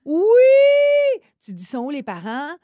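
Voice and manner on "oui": very high, loud drawn-out yes; excited squeal. Real, not acted. Agreeing with her co-host about underdressed teens, excited squeal. Exclaims over teens' skimpy winter clothes.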